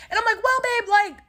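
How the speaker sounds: high-pitched mock-sweet "well babe"; high sassy retort. Speaker voices her own reply.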